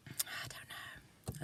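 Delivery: barely-there whisper. Real, not acted. Puzzling over her forgotten moan, whispered. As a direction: let it fade to breath. Trailing off, unvoiced.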